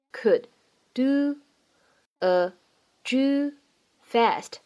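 every word said apart, slowly; slow word-by-word English. Shown as a demonstration. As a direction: read it one word at a time with pauses. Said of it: "在这样的一段英文对话当中呢".

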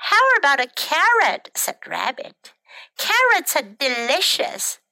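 lively, animated picture-book reading; children's story narration. A narrator's reading. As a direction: read it to small children with bouncy character lines.

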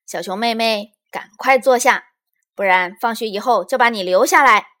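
loud, scolding order; bossy pretend teacher. A narrator's reading. Lizi as teacher threatens her pupil, bossy and loud. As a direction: lay down the law like a teacher.

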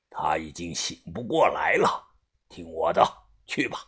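whispered, low and final. Acted. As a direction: hush it, cold and firm. Boss insists quietly.

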